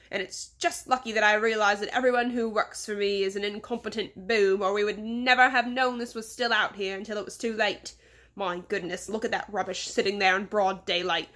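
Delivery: put-on exasperated English-accented tirade, loud on the outbursts; fuming English boss. Boss rants at Mister Seven about incompetent staff, fuming English accent.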